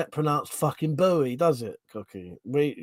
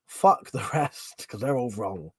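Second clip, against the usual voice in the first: whispered aside; 3 semitones lower than usual. Acted. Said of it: "whispered aside".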